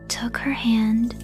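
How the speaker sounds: ordinary delivery, nothing marked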